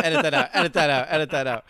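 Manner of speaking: loud, laughing "edit that out"; shouted request to the editor; loud plea. Real, not acted. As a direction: call out to cut it, loudly. Repeated loud joking plea.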